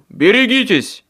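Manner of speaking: loud, high warning call; shout back. A narrator's reading. Michael shouting back to Harry, loud high call. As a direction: yell the name back.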